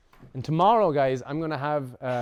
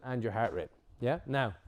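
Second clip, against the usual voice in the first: ordinary talk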